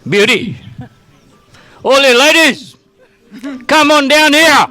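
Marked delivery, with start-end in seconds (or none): 1.8-4.7 loud call to the crowd. Real, not acted. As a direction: call them over, raised and bright. Calling the ladies over, crowd.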